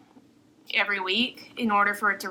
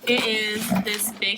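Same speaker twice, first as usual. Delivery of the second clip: normal talking voice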